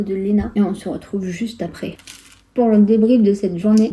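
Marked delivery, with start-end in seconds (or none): 2.5-3.9 talking through a mouthful; words round a bite of food; mouth full. Real, not acted. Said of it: "désolée, je parle la bouche pleine".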